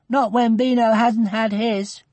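put-on high-pitched child's protest; high, unhappy child's voice; upset little girl. A narrator's reading. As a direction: voice a girl fretting over a pet. Upset girl refuses her tea.

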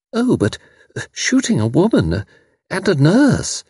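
very high, faltering protest; flustered guest. Acted. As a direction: stammer an appalled objection in a high voice.

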